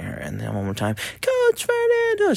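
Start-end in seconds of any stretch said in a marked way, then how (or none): 1.2-2.3 put-on high, drawn-out kid's call, louder; sing-song child shout, closer; louder child's call. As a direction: belt it out high like a little girl running up. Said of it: "She gets louder and louder".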